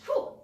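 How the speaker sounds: short high whew; quick workout exhale; exertion whew. Real, not acted. Exhaling during workout demonstration.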